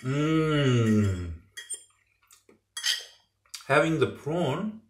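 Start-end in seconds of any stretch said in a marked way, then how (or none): 0.0-1.3 long falling "mmm" of enjoyment; savouring hum. Real, not acted. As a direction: hum slowly over a tasty bite. Savouring a mouthful of food.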